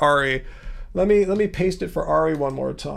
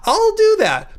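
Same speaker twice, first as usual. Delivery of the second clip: pitched way up, well above the usual voice; going high for emphasis; 14 semitones higher, 3 dB louder, slower than usual. Shown as a demonstration. Streamer showing critics he can go high for emphasis, high voice. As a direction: jump up high to emphasize the words. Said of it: "If I wanna go high to emphasize a word".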